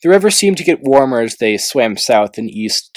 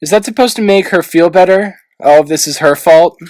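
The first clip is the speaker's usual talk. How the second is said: higher, louder scornful question; mock his logic; scornful challenge; 7 semitones higher, 7 dB louder than usual. A narrator's reading. Tsunami scolding Clay, scornful higher challenge.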